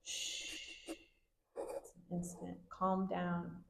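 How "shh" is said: long soft shush; gentle, sustained "shh"; kids' shushing. Shown as a demonstration. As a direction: hush like a class of kids. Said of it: "and the kids go".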